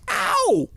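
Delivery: sudden high-pitched playful "ow"; high mock yelp. Clowning while typing a site, high mock yelp.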